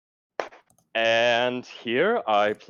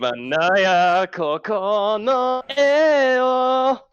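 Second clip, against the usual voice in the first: slow, high, louder mock-crying anime voice; excited anime fan; 12 semitones higher, 6 dB louder, much slower than usual. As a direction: wail it like a weepy anime hero. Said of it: "the weeb voice".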